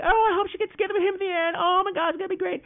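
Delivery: high, fast swooning gush; breathless high-pitched hoping; gushing fan. Retold or imitated. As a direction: squeal it like a hopeful romantic in the audience. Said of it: "you're like".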